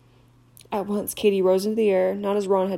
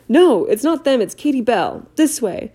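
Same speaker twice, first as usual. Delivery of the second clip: higher and louder, urgent; urgent shout; 4 semitones higher, 8 dB louder than usual. Acted. Harry to Hagrid, an urgent shout. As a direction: call it out and lead the way.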